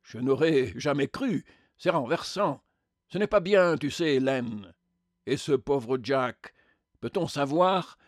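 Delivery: scandalised spectre, lifted and pressing; indignant spectre. Acted. Spectre questions the killer about poor Jack, indignant. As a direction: demand an account, full of reproach.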